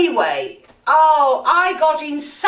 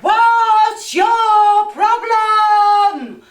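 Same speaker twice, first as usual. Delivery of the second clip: loud, slow, higher shout out the window; shouted question; 8 semitones higher, 6 dB louder, much slower than usual. Retold or imitated. Passenger yelling from the train window to the driver, loud shout. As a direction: bellow it slowly to the driver. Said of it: "I shouted".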